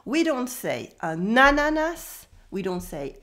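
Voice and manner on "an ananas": "ananas" stressed early, on the first syllables, higher; wrong early stress. Shown as a demonstration. Teacher showing what not to say, early stress on "ananas". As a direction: punch the start of "ananas", not its last syllable. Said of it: "We stress the last syllable".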